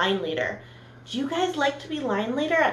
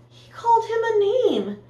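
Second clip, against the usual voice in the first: shocked gasp; 11 semitones higher, 5 dB louder than usual. Real, not acted. Teacher gasping to her class at the rude bus, shocked.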